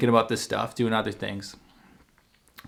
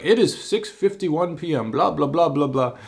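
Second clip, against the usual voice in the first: higher, stilted robotic read of the time; over the top mock quote; 4 semitones higher than usual. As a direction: parody his pompous message. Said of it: "over the top reply".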